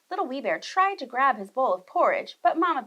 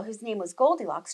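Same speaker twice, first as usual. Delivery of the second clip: ordinary delivery, nothing marked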